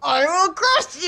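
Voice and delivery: barbarian. Pretend. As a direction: bellow it like a barbarian. Game emote, barbarian hero voice.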